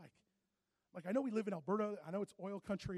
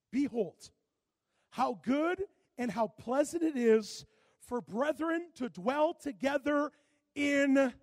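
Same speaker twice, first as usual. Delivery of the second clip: loud, rising sermon build; preaching at full force; 6 semitones higher, 12 dB louder, much slower than usual. Acted. Pastor preaching to his church, loud and rising. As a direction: drive it home to the congregation. Reads the psalm.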